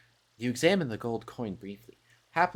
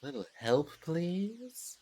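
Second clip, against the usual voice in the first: another huntress try; 6 semitones higher, 5 dB quieter, much slower than usual. Shown as a demonstration. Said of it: "what the Huntress should sound like".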